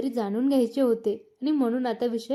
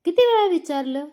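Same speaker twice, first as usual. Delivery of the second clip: angry accusation; 6 semitones higher, 5 dB louder than usual. Acted. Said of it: "Priya रडत रडत बोलू लागली".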